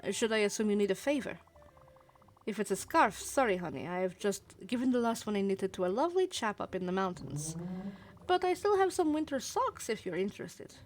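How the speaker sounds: put-on lively amateur Russian accent; gossipy old acquaintance with a put-on Russian accent. Marsha answers an unexpected visitor, teasing Russian accent. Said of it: "I'm doing my Russian accent for these".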